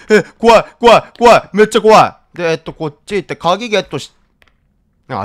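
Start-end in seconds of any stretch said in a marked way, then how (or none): 0.1-2.4 scared. Real, not acted.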